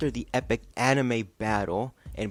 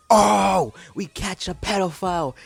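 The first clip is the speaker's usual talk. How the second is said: mock announcement; 9 semitones higher, 6 dB louder than usual. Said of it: "Instead of saying".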